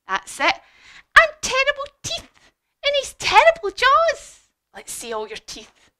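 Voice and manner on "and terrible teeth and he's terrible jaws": very high, loud mouse voice; dramatic storybook warning to children; mouse describing a monster. Acted. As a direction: relish each scary feature, pitched way up. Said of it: "And the mouse said".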